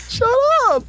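very high, drawn-out mock yell; mock screech. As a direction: squeal it as a playful protest. Mock shout back at co-host's joke.

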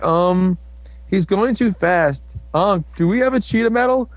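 lightly acted fretful request; flustered hero. Hero asks partner for medal.

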